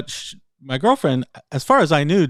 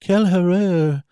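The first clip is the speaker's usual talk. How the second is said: lightly acted French sleep-talk, higher pitch; imitated sleep-talker; 7 semitones higher, 3 dB louder than usual. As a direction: imitate someone talking French while asleep. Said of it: "she started mumbling to herself".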